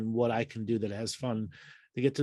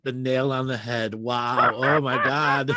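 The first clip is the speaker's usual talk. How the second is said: ordinary talk, nothing marked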